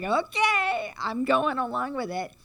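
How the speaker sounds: put-on high, near-tearful girlish voice; a young girl giving in, voice going up and catching; Dorothy's voice. Dorothy voiced in retold film.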